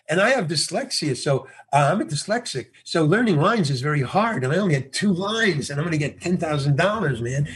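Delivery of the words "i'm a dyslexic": normal talking voice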